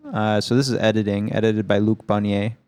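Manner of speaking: normal talking voice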